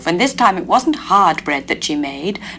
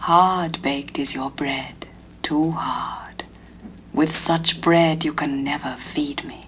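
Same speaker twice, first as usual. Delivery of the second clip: slow, breathy whispered lake fairy; soft whisper; 4 semitones lower, 5 dB quieter, slower than usual. A narrator's reading. Fairy lady refusing the young man's bread, whispered. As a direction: whisper it slowly like a fairy from the water. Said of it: "All she said was".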